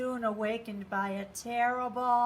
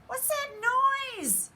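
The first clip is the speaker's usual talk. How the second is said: startled bunny; 5 dB louder than usual. A narrator's reading. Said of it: "he asked as he sat up in bed".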